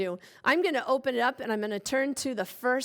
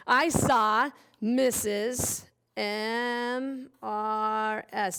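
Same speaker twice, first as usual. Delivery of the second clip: slow dictation; much slower than usual. Shown as a demonstration. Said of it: "slow dictation while writing".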